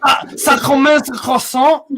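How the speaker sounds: put-on high loud French accent, slow. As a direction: shout it like a cartoon Frenchman. Joking French impression.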